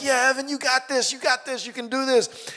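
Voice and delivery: high, eager cheering; rally a teammate. Retold or imitated.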